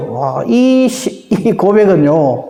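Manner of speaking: ordinary talk, nothing marked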